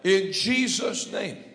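loud, impassioned preacher's prayer; fervent prayer. Acted. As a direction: pray it out with full conviction. Opening prayer at full force.

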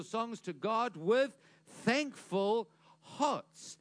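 high, ringing exhortation with punched key words; emphatic preaching. Acted. Preacher urging the congregation to always be thankful, emphatic preaching. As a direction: exhort the room, slowing heavily into the last words.

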